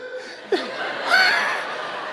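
squeaky airy laughter; dad laughing at his own teasing; high breathless laugh. Real, not acted. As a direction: crack up mid-story. Preacher laughs at own story.

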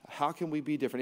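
ordinary talk, nothing marked

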